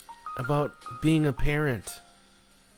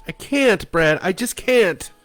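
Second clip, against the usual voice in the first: much higher and louder, near a wail; hysterical; 11 semitones higher, 8 dB louder, slower than usual. Acted. Wife refusing motherhood to her husband, hysterical wail. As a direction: break into a hysterical cry. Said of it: "She was hysterical".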